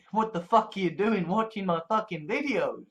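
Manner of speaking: ordinary talk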